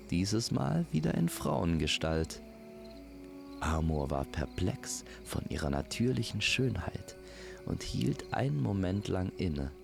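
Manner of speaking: plain reading voice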